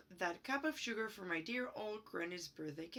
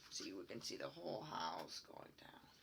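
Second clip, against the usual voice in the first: quiet aside; 13 semitones lower, 9 dB quieter than usual. Real, not acted. Aside showing picture.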